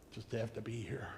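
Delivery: whispered, resigned mutter. Retold or imitated. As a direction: breathe it out under your breath. Mocking someone who just endures.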